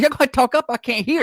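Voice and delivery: high-pitched hard-of-hearing complaint; retold call to speak up. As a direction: call down the table that you can't hear. Acts out own complaint at dinner.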